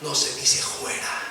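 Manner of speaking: mocking demon. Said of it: "Se burló y dijo".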